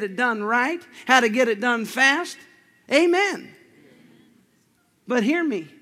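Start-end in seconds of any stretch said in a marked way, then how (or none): 2.9-3.5 high, loud amen; preacher's amen. Acted. Preacher calling amen to the congregation, high and loud. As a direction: ring out the amen.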